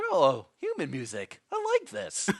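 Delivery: slow, loud, then squeaking high; dopey voice. Retold or imitated. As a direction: play a gullible dad, dopey and pleased. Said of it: "He's like".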